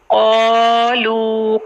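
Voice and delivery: क़ालू with a heavy क़ and the long ā held two counts, slow; क़ालू recited slowly, heavy qaaf, stretched aa; क़ालू slow tajweed with heavy qaaf; Qur'anic recitation. Shown as a demonstration. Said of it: "क़ाफ को heavy करेंगे".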